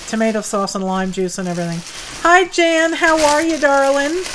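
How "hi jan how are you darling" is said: bright greeting. Real, not acted. Greeting a viewer in chat.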